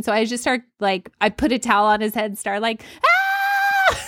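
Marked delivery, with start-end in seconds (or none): 3.0-4.0 high-pitched retold freak-out scream; panicked scream. Mom over an injured child, panicked scream. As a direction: shriek as if useless in an emergency. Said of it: "a scary situation".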